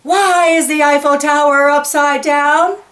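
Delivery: high, loud storybook yell; a loud, high yelled question. Acted.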